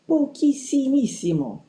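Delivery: "pochissimissimo" stretched out and pitched high; emphatic superlative. A narrator's reading. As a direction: lift and draw out "pochissimissimo" in mounting worry. Escalating how little time remains.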